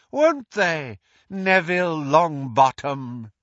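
high, slow, cold dark-lord voice; high menacing villain, drawn out; Voldemort gloating. Acted. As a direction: gloat over the fallen hero to the crowd. Voldemort decrees to the crowd.

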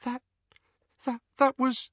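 small, high, halting whimper. A narrator's reading. Hermione as she is helped up, frightened whimper. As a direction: whimper it in broken little starts. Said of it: "Hermione whimpered".

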